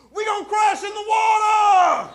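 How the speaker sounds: very high, loud, slow panicked scream; panicked shriek. Retold or imitated. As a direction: shriek it like a terrified passenger. Lady panicking about crash.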